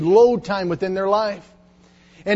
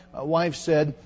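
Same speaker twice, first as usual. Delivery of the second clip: usual speaking voice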